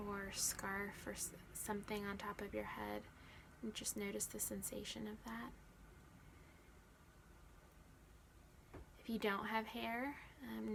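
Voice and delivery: measured body-scan guidance with long silences; guided meditation. Real, not acted. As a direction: guide attention gently, leaving room after each instruction.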